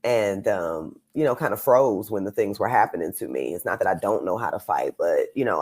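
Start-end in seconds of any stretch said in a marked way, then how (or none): none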